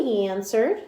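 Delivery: usual speaking voice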